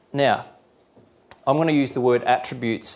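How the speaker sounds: normal talking voice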